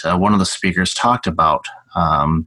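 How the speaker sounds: ordinary delivery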